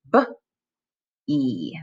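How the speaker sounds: B and E split into separate sounds. Shown as a demonstration. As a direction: segment each sound apart. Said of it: "break it into its component parts".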